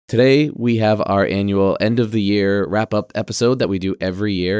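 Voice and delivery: ordinary talk, nothing marked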